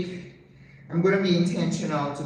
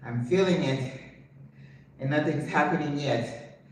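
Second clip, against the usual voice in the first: ordinary talk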